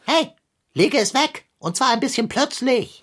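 high, forceful order; impatient command. Acted. Cat orders the phone put away.

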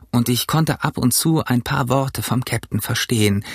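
ordinary delivery

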